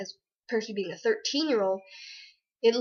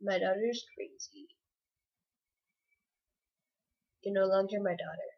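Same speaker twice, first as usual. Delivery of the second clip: deadpan mom; 3 semitones lower, 6 dB quieter than usual. Retold or imitated. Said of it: "she just looked at me like".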